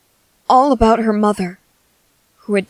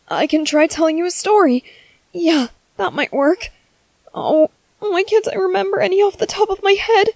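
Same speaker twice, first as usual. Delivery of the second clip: higher, frantic and flustered; panicking; 9 semitones higher, 3 dB louder than usual. Acted. Father panicking as his baby won't stop crying, higher. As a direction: panic while trying to hush the baby. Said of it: "I'm panicking".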